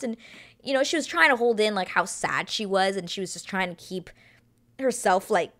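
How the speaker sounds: normal talking voice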